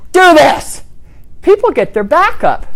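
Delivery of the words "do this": blunt order. Retold or imitated. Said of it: "But if you say".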